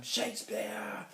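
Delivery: high, drawn-out exclamation; taunting cry. A narrator's reading. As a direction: draw the name out to torment the teacher. Taunting the teacher with the name.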